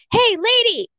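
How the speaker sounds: high-pitched mock yell; imitated angry yell. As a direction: call out accusingly, imitating an angry stranger. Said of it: "yells at me".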